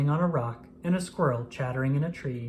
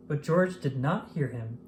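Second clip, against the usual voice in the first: ordinary delivery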